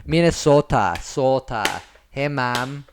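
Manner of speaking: loud, hammed-up Minnesota twang; Fargo-style Minnesota accent, projected. Shown as a demonstration. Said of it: "where you get that accent".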